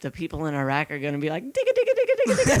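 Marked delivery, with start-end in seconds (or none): 1.4-2.6 squeaky high mock gibberish chant; high, fast mocking nonsense syllables. Mocking imagined Iraqis, high far voice.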